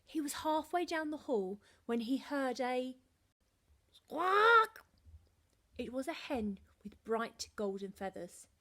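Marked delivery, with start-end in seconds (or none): 3.9-4.9 loud high squawk; loud squawking hen. A narrator's reading.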